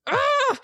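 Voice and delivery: high, frustrated squeal; exasperated cry. Acted. Woman giving up on her partner, high exasperated cry. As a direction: let out a high groan of exasperation. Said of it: "And she's like".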